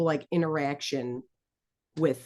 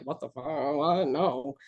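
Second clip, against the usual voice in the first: slow, soft, dumbfounded shrug of a line; baffled mutter; 4 semitones lower, 3 dB quieter, slower than usual. Retold or imitated. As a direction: play Jaime stunned and lost for words. Jaime baffled in retold book scene.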